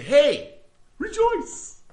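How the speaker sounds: Hey rejoice squeaked high and loud, falsely cheerful; mock cheery. Preacher mocking a cheery greeting to disaster victims, high. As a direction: chirp it like a tone-deaf visitor. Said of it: "and say".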